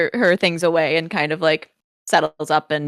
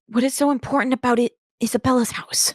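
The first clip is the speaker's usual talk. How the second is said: hushed question; 6 semitones higher than usual. Acted.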